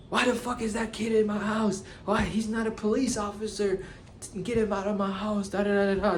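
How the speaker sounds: high-pitched, angry imitated yelling from the kitchen; screaming man. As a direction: mimic a furious homeowner shrieking at the cops. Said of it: "he's, like, screaming".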